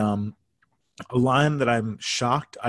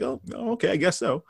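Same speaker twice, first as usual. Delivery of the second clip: puzzled, halting concession; befuddled; 7 semitones higher, faster than usual. Retold or imitated. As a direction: imitate someone confused, giving in uncertainly. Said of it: "looks, so, like, befuddled".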